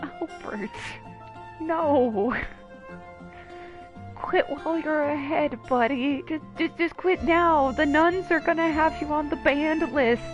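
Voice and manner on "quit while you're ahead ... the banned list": ordinary talk, nothing marked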